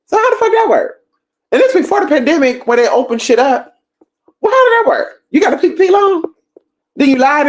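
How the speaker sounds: high, squealing disbelief; incredulous rant. Real, not acted. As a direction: voice shoots way up, mocking how impossible it is.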